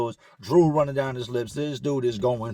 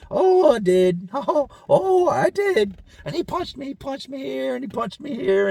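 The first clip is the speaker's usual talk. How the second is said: put-on high, childlike mumbling cellmate; high put-on voice of a troubled man; 11 semitones higher, 4 dB louder than usual. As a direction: voice the slow-witted cellmate, pitched up. Cellmate voiced in jail story.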